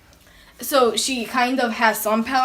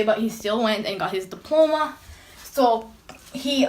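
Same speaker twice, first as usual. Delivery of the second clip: ordinary talk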